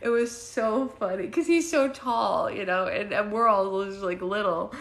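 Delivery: talking through laughter; laughing. Real, not acted. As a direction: speak while still laughing. Laughing while telling.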